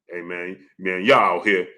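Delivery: low-pitched mock of a puffed-up talker; chest-out imitation. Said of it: "you said it with your chest out".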